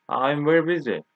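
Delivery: ordinary delivery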